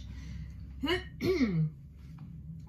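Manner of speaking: a polite ahem before giving thanks; throat clearing. Acted. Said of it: "he cleared his throat".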